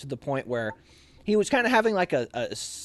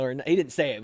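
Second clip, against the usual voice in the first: normal talking voice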